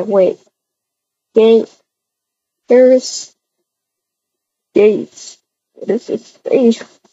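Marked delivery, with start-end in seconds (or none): none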